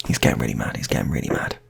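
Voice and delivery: plain reading voice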